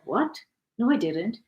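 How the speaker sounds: high, startled denial; surprised. Retold or imitated. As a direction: act caught off guard. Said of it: "And I was like".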